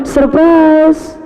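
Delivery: high, bright announcement of news; "Surprise" called out high and thrilled; wife's excited surprise. Retold or imitated. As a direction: sing it out like the wife springing happy news. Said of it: "ketika istri saya bilang".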